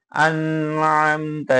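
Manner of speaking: আন Amta with the n and m held too long; overstretched, delayed আন Amta; wrongly drawn out. Shown as a demonstration. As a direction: linger on the n of আন and the m of Amta. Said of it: "এরকম পড়া যাবে না".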